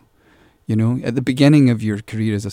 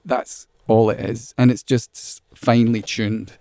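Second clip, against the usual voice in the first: ordinary delivery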